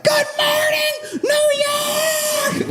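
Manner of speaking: very high, drawn-out imitated screech; mock shouting down. Lady opening her speech over protesters, mock screaming. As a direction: scream the greeting over the crowd, hammed up. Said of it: "she's just trying to shout everybody down".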